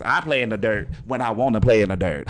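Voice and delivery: ordinary talk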